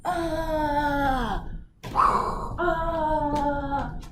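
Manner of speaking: drawn-out playful ah sounds; sung-out ah; mock wailing ah. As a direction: play a bouncing air molecule. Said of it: "I'd start going".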